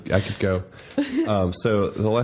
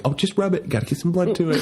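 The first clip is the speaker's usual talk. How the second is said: breezy reassurance; 9 semitones higher than usual. Retold or imitated. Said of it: "our code would be like".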